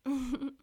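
sharp burst of laughter; loud laugh. Acted. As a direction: cackle out loud.

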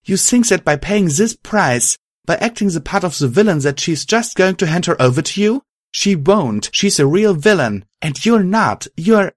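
high, insistent argument; yelled appeal. A narrator's reading. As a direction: argue urgently with the villain, pitch lifted. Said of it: "Violet yelled".

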